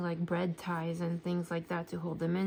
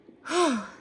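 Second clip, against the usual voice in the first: heavy sigh; 6 semitones higher, 7 dB louder than usual. Real, not acted. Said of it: "Sorry, I'm a little bit out of breath".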